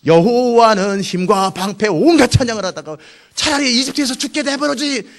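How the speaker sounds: drawn-out, high, loud mock praise; praise turned grumbling. Israelites past the Red Sea praising, then complaining loudly. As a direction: belt it slowly, rising into a whiny protest. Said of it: "삼 일 만에 원망해버리세요".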